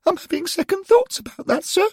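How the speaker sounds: put-on a young lad asking nervously; schoolboy Derek. A narrator's reading. Derek asking the angels about the portal, high and curious.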